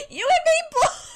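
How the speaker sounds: excited. Real, not acted. Commiserating with a chat comment.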